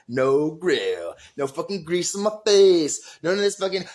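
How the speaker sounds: loud, rising, gleeful rant; building high-energy excitement; stoked. Retold or imitated. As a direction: re-enact being thrilled to be off the grill. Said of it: "I'm, like, stoked".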